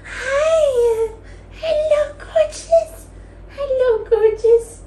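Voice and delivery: very high cooing hello; pet-greeting voice. Real, not acted. Greeting a gorgeous pet, high-pitched cooing. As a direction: coo a sky-high greeting to a beloved pet.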